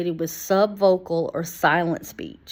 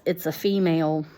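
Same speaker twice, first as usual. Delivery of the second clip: ordinary delivery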